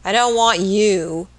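loud, slow, blunt dismissal; decisive. A narrator's reading. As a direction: dismiss her loudly, stretching each word. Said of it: "said Mister Marks decisively".

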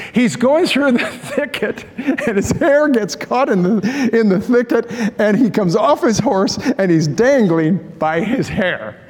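holding back laughter while telling it; slow telling, laughter breaking through; amused storytelling. Real, not acted. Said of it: "I'm gonna laugh when I tell".

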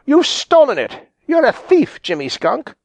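high-pitched angry accusation; cross storybook fox accusing a thief; snapped. A narrator's reading. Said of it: "snapped Granny Fox".

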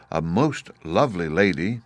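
ordinary delivery

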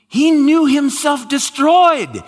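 full-force preaching. Acted. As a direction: thunder it to the congregation. Climactic preaching.

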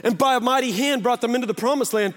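rising preacher cadence with a punched hand on each repeat; preaching refrain. Acted. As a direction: drive the refrain home like a pulpit call. Builds repeated mighty hand refrain.

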